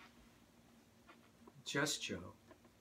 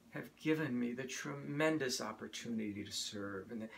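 ordinary talk

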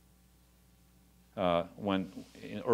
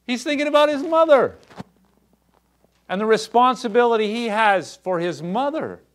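far higher and louder, climbing to a passionate peak; preaching at full force; 10 semitones higher, 8 dB louder than usual. Acted. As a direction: drive the point home to the congregation.

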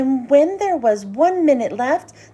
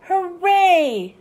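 bright high cheer, a shade louder; cheering scream; 5 semitones higher, 4 dB louder than usual. A narrator's reading. The team cheers together, loud and high. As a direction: throw the cheer up high like a kid. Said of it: "screamed the dream team".

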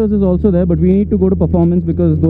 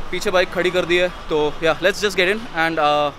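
loud; 5 semitones higher, 9 dB louder, faster than usual. Real, not acted. Vlogging loudly outside the store.

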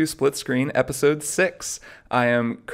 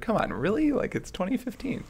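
high, softer incredulous whine; exasperated disbelief; 9 semitones higher, 5 dB quieter than usual. Acted. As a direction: plead in mock disbelief, pitched way up. Acting out an exasperated customer.

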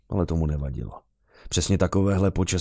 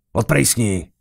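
loud, raised angry order; angrier dismissal; 4 semitones higher, 5 dB louder, slower than usual. A narrator's reading. Annoyed officer chasing off the beggar boy, angry shout. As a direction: drive him off angrily. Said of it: "Muž se naštval ještě víc".

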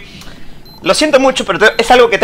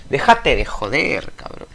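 much softer, slow grumble; under the breath; muttered; 7 semitones lower, 11 dB quieter, slower than usual. Real, not acted. Player grumbling at the creator, quiet.

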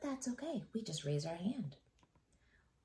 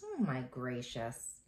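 usual speaking voice

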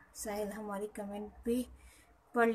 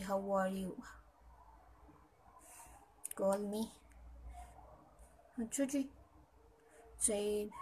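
normal talking voice

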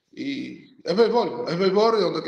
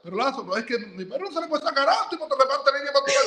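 laughing quote; 8 semitones higher than usual. Retold or imitated. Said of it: "pero entonces dice".

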